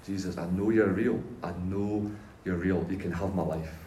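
retold prayer, spoken gently and privately. Alone in bedroom praying to Jesus, quietly surrendering. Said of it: "I just start to pray".

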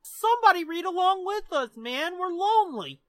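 hammed-up needy whine; mock pleading. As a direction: beg listeners with exaggerated loneliness. Mock plea to listeners.

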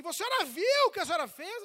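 loud, fast, high scolding; imitated stern policeman. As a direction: confront the driver sharply. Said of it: "ele chegou e falou assim".

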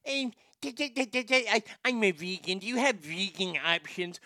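put-on fussy customer voice; mock vegan. Host mimicking a vegan asking about options, mocking voice. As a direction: imitate a tiresome vegan diner. Imitates a vegan ordering food.

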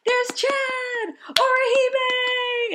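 very high, excited cheers; cheering fan. Retold or imitated. Fan cheering characters on screen, very high excited calls. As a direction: squeal each name like a thrilled fan. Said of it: "I was like".

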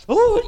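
sudden high, loud "ooh" of a bawl; mock ugly-cry wail. Preacher shows how hard he cried, mock wailing. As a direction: imitate a burst of sobbing. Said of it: "he's ugly crying".

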